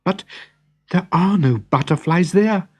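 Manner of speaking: high, urgent interjection; girl interrupting the prince with a racing heart; anxious interruption. Acted. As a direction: blurt it out to stop him. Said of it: "Natalia interrupted".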